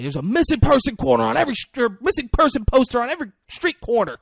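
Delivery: high mock screaming rant. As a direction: lay into him, demanding he confess. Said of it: "He's screaming".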